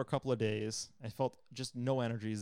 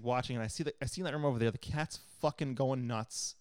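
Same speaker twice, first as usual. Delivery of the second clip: ordinary talk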